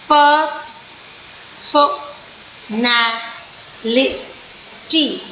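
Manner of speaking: "personality" stretched very slowly into five syllables; slow teaching demo of "personality". Shown as a demonstration. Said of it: "it is five syllable word".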